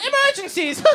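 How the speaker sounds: very high cartoon Irish squeal; hammed-up high Irish accent; crazy Irish dad. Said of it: "He's got this crazy voice".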